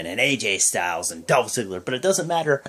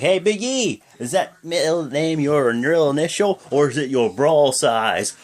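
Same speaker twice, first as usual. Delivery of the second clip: taunting wrestler; 6 semitones higher, 4 dB louder than usual. Retold or imitated. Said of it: "and I'm like".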